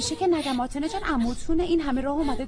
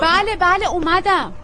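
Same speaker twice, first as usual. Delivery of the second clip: high, loud answer toward the door; raised reply from inside the house; calling back; 7 semitones higher, 7 dB louder, slower than usual. Acted. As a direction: shout you are coming.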